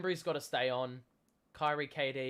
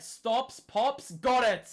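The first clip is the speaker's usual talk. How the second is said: rising, louder call as the shot drops; excited play-by-play; 8 semitones higher, 9 dB louder, much slower than usual. Real, not acted. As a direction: call it like a hype commentator. Live play-by-play call, Kyrie scores.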